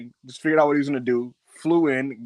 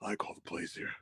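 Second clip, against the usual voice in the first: hushed, low, nearly voiceless imitation; whispered assertion of who is boss; Roman; 6 semitones lower, 17 dB quieter than usual. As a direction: drop to a quiet warning under your breath. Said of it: "He's like".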